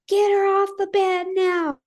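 high loud insistent order; dispatcher demanding. Retold or imitated. 911 dispatcher ordering mother to move body, high and loud. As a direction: bark it as an urgent command. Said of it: "And she's all".

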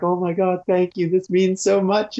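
high, mock-tearful gratitude; tearful brother. Dave's emotional brother thanking the riders, mock tearful and high. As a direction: gush thanks, lightly hammed-up tears. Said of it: "and he's like".

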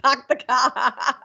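slow, laughing Boston "Pak the ka" with no r; sheepish Boston accent through laughter. Pretend. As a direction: drag out a giggly Boston impression. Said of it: "my Boston accent".

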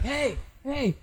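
high-pitched imitated hail; old man calling out. Old man calls out running over.

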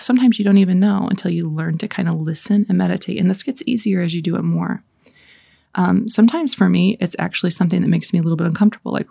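normal talking voice

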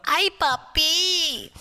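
high, slow, drawn-out mock wife calling papi; wife's loving call. Host imitating the guest's wife calling him, high and drawn out. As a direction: stretch it out like a doting wife. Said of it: "A K A his wife calls him".

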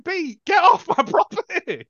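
high, loud, stretched-out order; hammed-up furious shout breaking into a laugh; mock outraged yell. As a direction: bellow it at an imagined trespasser. Acting out order to trespasser.

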